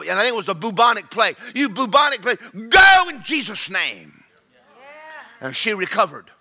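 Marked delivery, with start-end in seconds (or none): 1.5-4.2 high, loud, slow command; commanding exorcism. Retold or imitated. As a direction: drive the demon out. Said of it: "he cast that thing".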